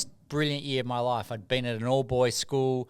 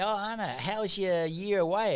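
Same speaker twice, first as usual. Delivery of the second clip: light friend voice; 7 semitones higher than usual. Retold or imitated. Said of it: "my friends would say".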